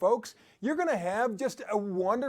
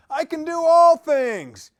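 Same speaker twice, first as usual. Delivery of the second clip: much louder and higher, slow on "all things"; fervent proclamation; 7 semitones higher, 12 dB louder, slower than usual. Acted. Preaching Philippians to listeners, loud slow fervent proclamation. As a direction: proclaim it slowly, full force.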